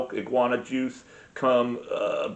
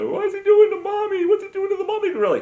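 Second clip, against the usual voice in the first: put-on much higher, loud, fast child's squeak; curious little kid; 6 dB louder, faster than usual. Little Samuel at the zoo asking dad about gorillas, high kid voice. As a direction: squeal it like a wide-eyed small boy.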